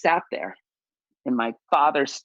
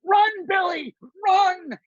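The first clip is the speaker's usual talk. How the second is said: much higher, shouted "Run, Billy, run!"; father yelling; 4 dB louder, much slower than usual. Retold or imitated. Father yelling at his son from the stands, a loud high shout. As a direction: holler him down the base path. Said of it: "my father's going".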